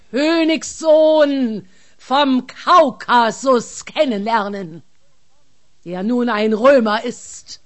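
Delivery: slow, booming giant roar; thundering. Acted. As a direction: thunder it down at the soldiers. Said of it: "Hierauf donnerte der Riese".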